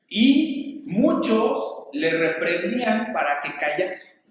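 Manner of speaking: usual speaking voice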